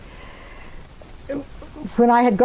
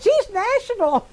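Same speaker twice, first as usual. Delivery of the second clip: high, slow teasing imitation; mock-impressed teasing; 11 semitones higher than usual. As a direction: draw it out high, poking fun. Repeats Swami's teasing line.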